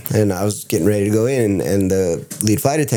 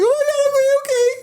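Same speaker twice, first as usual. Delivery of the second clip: much higher, squeaky overjoyed okays; thrilled squeal; faster than usual. Retold or imitated. Av geek answering the flight attendant, squealing with excitement. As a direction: squeal like a giddy kid. Told he'll visit the cockpit.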